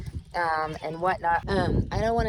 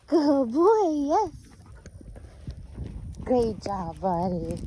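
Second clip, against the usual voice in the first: happy dog praise; 8 semitones higher, much slower than usual. Real, not acted. Trainer praises her dog for slowing down, high happy praise voice. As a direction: lift the voice way up to reward him.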